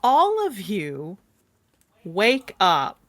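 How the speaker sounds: slow, suspenseful narration with long pauses; dramatic scene setting. A narrator's reading. Game master wakes players to a massive storm, slow and suspenseful. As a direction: draw out each image for suspense.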